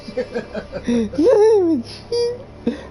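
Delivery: loud high burst of laughter; high, loud cackle. Real, not acted. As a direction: crack up loudly. Laughing at own joke.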